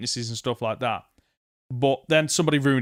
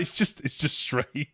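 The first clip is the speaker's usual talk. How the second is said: usual speaking voice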